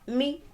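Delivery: Mi pitched well up; high-pitched demonstration of Mi. Shown as a demonstration. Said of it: "Or is it mi?".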